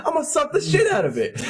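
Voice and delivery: high-pitched mock yell without much volume; retold yelling. Driver orders the kid into his car, mock yelling. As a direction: egg him on, strained and insistent. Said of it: "I kept yelling at him".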